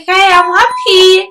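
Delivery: slow, high, drawn-out mock cheer; unconvincing happiness. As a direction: imitate a husband badly faking joy. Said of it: "He wasn't showing excitement".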